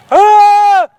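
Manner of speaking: high wordless yell into the valley; echoing shout. Real, not acted. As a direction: shout out to hear the echo. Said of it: "একটু চেঁচাও দেখি জোরে".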